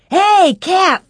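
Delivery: put-on high, loud boys' shout. A narrator's reading. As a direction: call out high to a teammate. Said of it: "they shouted".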